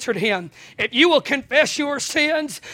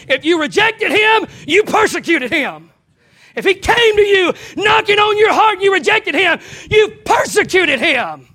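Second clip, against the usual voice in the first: high, loud preacher's cry; preaching shout; 7 semitones higher, 6 dB louder than usual. Real, not acted. Preacher warning the congregation, loud high preaching cry. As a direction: drive it home at full force.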